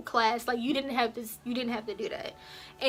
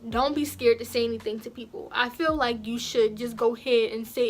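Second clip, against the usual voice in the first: ordinary delivery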